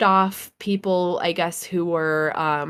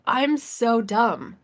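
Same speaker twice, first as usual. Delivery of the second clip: self-mocking; 4 semitones higher, much slower than usual. Mocking herself, starstruck.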